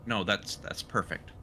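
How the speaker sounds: ordinary delivery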